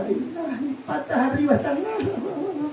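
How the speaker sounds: high, soft hammed-up whimper; mock helpless wail. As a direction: play someone swept off their feet, high and feeble. Imita al que cae seducido. (quejido burlón, agudo y débil)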